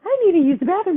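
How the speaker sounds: put-on high, loud little kid voice. Little boy telling the bathroom cleaner he needs in, imitated child voice. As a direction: imitate a child piping up urgently.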